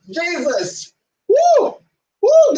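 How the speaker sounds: high hooting whoops; hooting shout. Real, not acted. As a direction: let out loud whoops. Hooting outburst.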